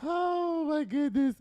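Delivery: high, stunned oh my goodness; surprised gasp. Real, not acted. Host reacting to a shocking headline, stunned. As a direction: caught off guard by the news.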